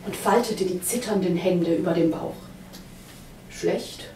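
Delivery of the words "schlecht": mournful. A narrator's reading. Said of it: "sagte Herschele betrübt".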